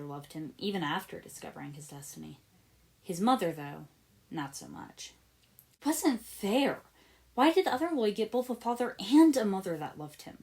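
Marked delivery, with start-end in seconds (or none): none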